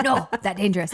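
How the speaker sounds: loud, blunt caveman warning. Retold or imitated. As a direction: bark it like a caveman. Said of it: "that caveman voice".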